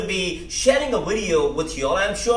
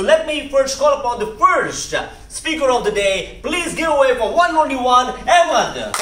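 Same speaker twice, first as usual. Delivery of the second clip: louder, higher introduction building to the name; announcer call-up; 4 semitones higher, 5 dB louder than usual. Real, not acted. Host calls up the first speaker of the day, announcer call-up.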